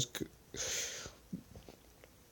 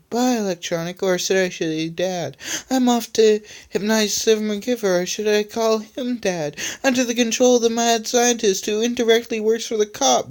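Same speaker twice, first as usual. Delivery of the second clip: villain voice; 9 semitones higher than usual. Acted. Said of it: "laughing evilly".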